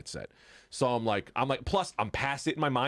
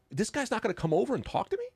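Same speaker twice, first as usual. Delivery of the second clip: much higher, worked-up griping; mock indignant rant; 11 semitones higher than usual. Annoyed that Corden won't come shake his hand, mock indignant. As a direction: huff about being snubbed.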